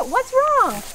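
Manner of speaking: high-pitched voice for a pet; cooing to an animal. Real, not acted. Talking to a goat that balks.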